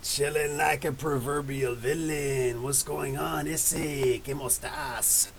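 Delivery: slow, hammed-up Spanish accent on the greeting; crappy Spanish accent. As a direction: greet them like Zorro in a bad Spanish accent. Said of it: "a really crappy freaking Spanish accent".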